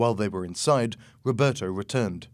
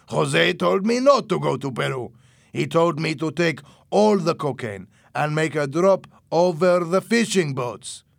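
loud, raised character voice; scheming Louis, insistent; 6 semitones higher, 5 dB louder than usual. A narrator's reading. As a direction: insist forcefully on the story.

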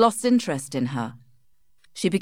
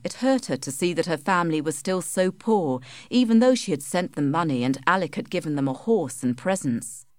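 plain reading voice

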